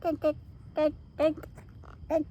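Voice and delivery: small ducks calling for food; small duck dink. A narrator's reading. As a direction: pipe it like a tiny duck. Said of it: "They say".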